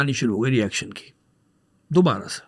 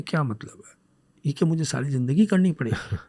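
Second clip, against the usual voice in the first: much softer, said only in his head; inner thought; 3 semitones lower, 9 dB quieter than usual. Retold or imitated. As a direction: voice a silent thought, dropped low and quiet. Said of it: "منہ سے نہیں بولا".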